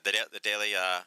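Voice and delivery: usual speaking voice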